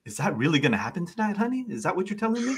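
quick question, lifting high on honey; flirty bartender. Acted. Bartender teasing a newcomer about tonight, high and flirty. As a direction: tease the customer as the bartender. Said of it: "it's like".

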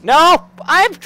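very high, loud startled cries; high-pitched panic; panicked yelling. Real, not acted. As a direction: shriek in shocked disbelief. Panics at sudden attack in game.

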